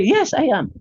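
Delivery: high-pitched, declaring answer; emphatic affirmation. Acted. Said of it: "Kaya pag sinabi kang". (mataas at madiing pagsagot)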